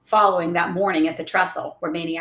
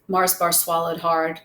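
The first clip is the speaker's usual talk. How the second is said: ordinary delivery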